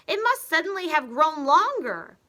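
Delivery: put-on high loud old wife; bewildered squawk; wife baffled by her stick; alarmed Mrs Twit.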